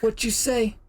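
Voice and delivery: high, strained captain impression; captain's smoker voice. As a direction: imitate a smoker captain challenging someone. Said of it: "He definitely has the smoker voice".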